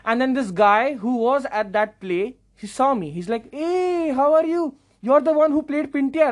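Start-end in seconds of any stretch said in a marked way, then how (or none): 3.5-6.3 high-pitched delighted fan greeting; imitated fan, pitched way up; excited recognition. Excited theatregoer spots him in lobby.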